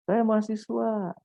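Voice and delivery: "Saya mahasiswa" exclaimed on a sustained high pitch, falling at the end; exclamation intonation. Shown as a demonstration. Lecturer contrasting intonations for students, exclamation intonation. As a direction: hold "Saya mahasiswa" high, then drop to close the exclamation. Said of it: "intonasinya berbeda".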